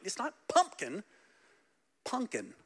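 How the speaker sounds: firm correction, Punkin said PUN-kin; correcting the name. Shown as a demonstration. Pastor correcting people who mispronounce the dog's name. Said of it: "I'm like".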